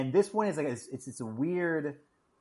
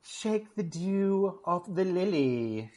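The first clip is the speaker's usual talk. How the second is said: slow, higher put-on voice; catchphrase voice; 5 semitones higher, much slower than usual. Co-host delivering his pet bathroom-break saying, slow put-on voice. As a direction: draw it out as a mock-genteel saying. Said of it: "as [name] likes to say".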